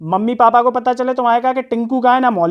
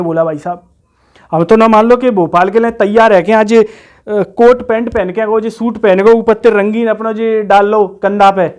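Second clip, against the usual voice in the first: loud village lad protesting his case; rustic job candidate; 3 semitones lower, 4 dB louder than usual. Acted. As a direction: plead and boast loudly in rustic dialect. Plays rustic candidate boasting and protesting.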